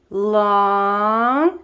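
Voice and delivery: chanting long notes; demo rhythm. Shown as a demonstration. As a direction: stretch each long like a held note. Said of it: "like this".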